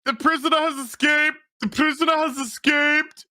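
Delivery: high, urgent guard's call; calling out an escape in a put-on high voice; mock alarm cry. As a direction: sound the alarm, hammed-up and high.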